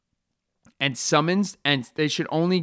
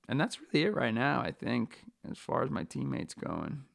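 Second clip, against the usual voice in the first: ordinary delivery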